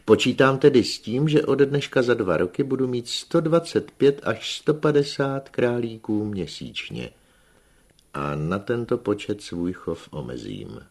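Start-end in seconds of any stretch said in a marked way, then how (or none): none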